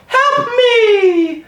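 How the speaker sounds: very high, eerie falsetto cry; mock creepy cry for help; creepy yell. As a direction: imitate a creepy high-pitched wail. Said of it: "he'd real creepily yell".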